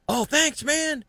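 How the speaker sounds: high-pitched mock thanks from the other angler; grateful stranger. Mimicking the boater he helped, high mock thanks. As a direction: squeak out a goofy thank-you.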